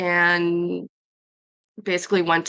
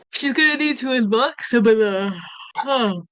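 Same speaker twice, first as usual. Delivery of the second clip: yawning; 5 semitones higher than usual. Real, not acted. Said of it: "Repeat that after you're done yawning".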